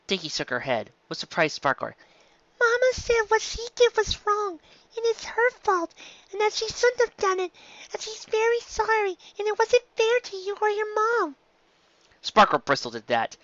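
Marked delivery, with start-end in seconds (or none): 2.6-11.3 a little filly passing on her mama's apology; earnest filly. A narrator's reading. Filly Dinky explains apology.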